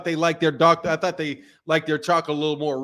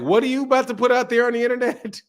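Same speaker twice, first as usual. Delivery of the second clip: higher-pitched wifely challenge; imitating his wife; 7 semitones higher, faster than usual. Wife challenging husband's internet video, higher imitated voice. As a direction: raise the pitch to mimic her demanding question. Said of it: "my wife was like".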